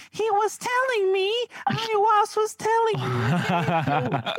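put-on very high, slow boyish voice; little boy Crowley voice. Mocking friend's take on Crowley, high boyish voice. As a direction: squeak it out like a small boy, dragging the words. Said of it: "That's how he talks".

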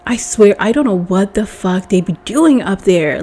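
normal talking voice

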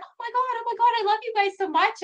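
high, fast squeal of a starstruck fan; imitating a gushing fan. Guest imitates screaming fan outside HomeSense, high excited gush. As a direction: gush like a fan meeting her idols. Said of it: "and she was just like".

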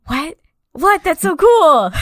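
gasp, then high excited disbelief; amazed reaction. Retold or imitated. Co-host relives learning the child actor's identity, thrilled surprise.

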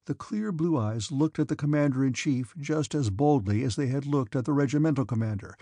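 ordinary delivery, nothing marked

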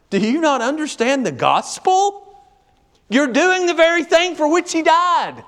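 high, loud preaching at full force; fiery preaching. Acted.

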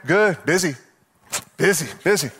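tired, droning "busy, busy"; weary reply. Retold or imitated. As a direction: answer like it's the hundredth time. Acted reply, far timbre.

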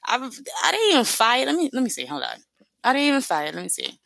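ordinary talk, nothing marked

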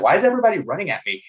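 high, hurried puzzled question; retold bewilderment. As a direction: blurt it out fast, mock-baffled. Retelling his confusion at paintball.

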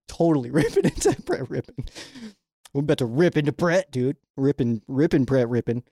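ordinary delivery

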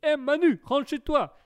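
high, hollered catchphrase; comic sketch call. Retold or imitated. Streamer repeats a viewer's running joke, hollered high. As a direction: call out to Manu in mock urgency. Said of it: "qui a toujours cette blague incroyable".

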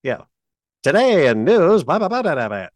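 mock 1940s newsreader; brassy vintage radio announcer; old-time radio voice. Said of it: "the old-time radio voices were like".

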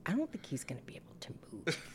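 put-on fast hushed kid worry; worried whisper. Retold child whisper.

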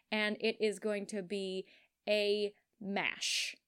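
usual speaking voice

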